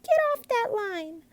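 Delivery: put-on high loud girl's demand; cried out. A narrator's reading. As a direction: order it, high and urgent. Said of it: "she cried".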